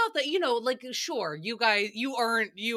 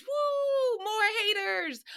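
mock cheer; 12 semitones higher, slower than usual. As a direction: cheer it on like a fan.

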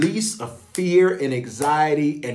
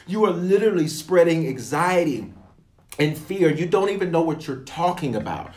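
usual speaking voice

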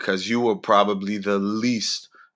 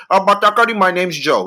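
higher-pitched mock foreign accent; Joe's mock accent; 7 semitones higher, 4 dB louder than usual. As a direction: imitate the store clerk's accent for laughs. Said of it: "'cause that's how he sounded".

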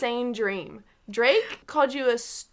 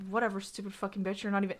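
low, quiet brush-off; dismissive, not yelling; 4 semitones lower, 10 dB quieter, faster than usual. Retold or imitated. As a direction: toss the insult off under your breath. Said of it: "he didn't even, like, yell it at me".

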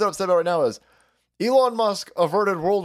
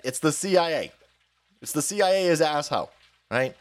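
ordinary talk

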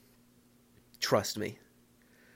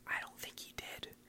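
whispered mock-doubtful aside; hushed aside; 15 dB quieter than usual. Podcaster whispering about his future self, hushed aside. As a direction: whisper it very softly, as if confiding.

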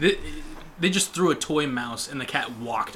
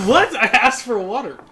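mock indignant; 9 semitones higher, 10 dB louder than usual.

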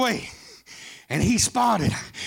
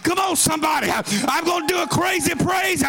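preacher whipping the congregation into a crazy praise; full-force preaching; 7 semitones higher than usual. Acted. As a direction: holler it out, building higher each line. Preacher erupts again into shouting.

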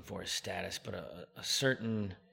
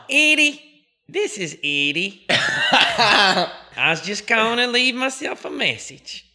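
much higher, louder Texas country twang with laughter; Eddie Speed impression; 16 dB louder, slower than usual. Leaving Eddie a message from himself in a Texas twang. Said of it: "message from himself".

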